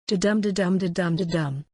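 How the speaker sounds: every "dum" stressed in "Da-dum, da-dum"; steady run of "da-dum", weak then strong; iambic rhythm. Shown as a demonstration. Teacher beating out a sonnet line, "da-dum" in iambic rhythm. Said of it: "its pattern is iambic pentameter".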